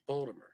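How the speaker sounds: soft "Baltimore" as Bawl-duh-mer; local pronunciation of Baltimore. Shown as a demonstration. Repeating what he heard himself, "Baltimore" as Bawl-duh-mer. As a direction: murmur "Baltimore" with a -mer ending. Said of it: "They pronounce it".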